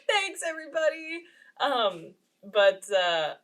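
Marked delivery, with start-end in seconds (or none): 0.1-1.2 high-pitched mock gratitude; forced thanks. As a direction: squeak out a polite thank-you, retold. Retells thanks for repeated same gift.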